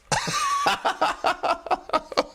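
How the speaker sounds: cracks up laughing; big laugh at the joke; high laugh. Real, not acted. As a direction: free laughter. Laughs at own bit.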